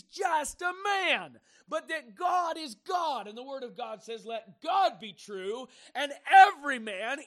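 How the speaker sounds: loud, high, full-force preacher shouting; fiery preaching. Acted. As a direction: thunder it from the pulpit.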